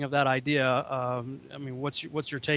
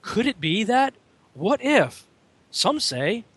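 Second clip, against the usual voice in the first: higher-pitched, leading questions; mock speculating; 8 semitones higher, slower than usual. Imitating how the show words things, mock speculating. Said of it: "the way they word things".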